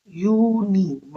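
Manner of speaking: यूनी drawn out very slowly to show the "yoo" sound at the start; stretched "yoo" sound. Shown as a demonstration. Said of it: "but यू का sound है". (यूनी को बहुत खींचकर, "यू" की आवाज़ दिखाते हुए)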